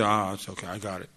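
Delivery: lightly voiced husband shrugging it off; dismissive husband. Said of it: "Don't say".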